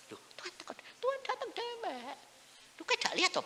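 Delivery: very high, imitated insisting voice; incredulous insisting. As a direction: squeak it in disbelief at papa. Meyakinkan papa yang tidak percaya. (ngotot dengan suara tinggi)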